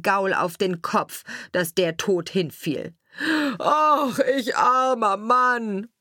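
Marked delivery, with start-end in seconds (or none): 3.6-5.9 high, loud, drawn-out wail; wailing lament. A narrator's reading. Carter who has struck his own horse dead, loud wailing lament. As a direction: cry out in self-pity, long and loud. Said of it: "rief er".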